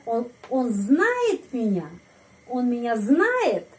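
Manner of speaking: slow question, знает pitched sharply upward; stress on знает. Shown as a demonstration. Teacher demonstrates upward question intonation, stress on знает. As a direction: stretch and raise знает, dropping after the peak. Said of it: "Di sini intonasinya ke atas".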